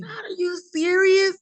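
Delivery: high, loud cry of disbelief at God; reacting to being told to care for him; stunned disbelief. Telling God in disbelief, high and loud. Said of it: "And I was like".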